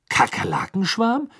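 high, rising puzzled question; baffled. A narrator's reading. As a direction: echo it in bewildered disbelief. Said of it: "sagte er verdutzt".

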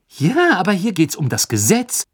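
high, loud worried plea. A narrator's reading. Hermine warns Ron about the law, worried and loud. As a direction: Hermine pressing with worry. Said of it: "sagte Hermine mit besorgtem Blick".

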